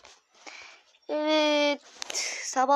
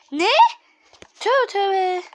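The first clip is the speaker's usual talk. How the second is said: loud, high-pitched disbelief; raised-voice shock at what just appeared; shocked exclamation; 7 semitones higher, 12 dB louder, slower than usual. Real, not acted. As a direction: blurt it out, scandalised. Shocked by what the game shows.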